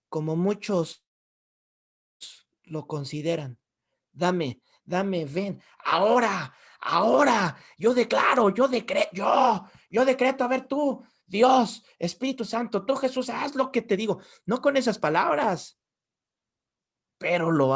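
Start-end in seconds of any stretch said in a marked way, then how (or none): 4.1-14.2 mock demanding prayer. Believers ordering God to act now, mock demanding. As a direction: order God around, hammed-up and insistent.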